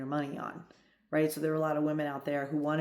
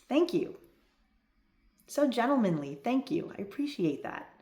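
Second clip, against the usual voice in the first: gracious thanks; 6 semitones higher than usual. Shown as a demonstration. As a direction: accept his gesture graciously and gently. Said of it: "Feminine is".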